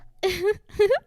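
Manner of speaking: sudden bright giggle; a high, sharp burst of laughter. A narrator's reading.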